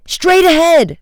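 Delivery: high, loud contradicting shout; countering. A narrator's reading. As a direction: shout it over the other suggestion. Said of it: "Frank countered".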